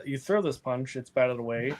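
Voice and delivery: ordinary talk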